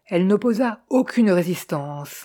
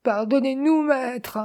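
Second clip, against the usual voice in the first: high, drawn-out entreaty; sobbing plea; 7 semitones higher than usual. A narrator's reading. Woman on her knees begs the master, high sobbing plea. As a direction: beg pardon in a raised, acted wail. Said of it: "se laissa glisser à genoux et sanglota".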